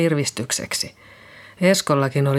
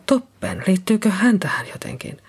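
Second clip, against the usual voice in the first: hushed whisper; 4 semitones higher than usual. Acted. Liisa realises, alarmed.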